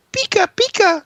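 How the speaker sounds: very high squeaky "Pika, Pika"; Pikachu toy voice. Retold or imitated. Parent imitating a Pikachu Furby, high and squeaky. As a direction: chirp it like the toy. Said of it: "who was like".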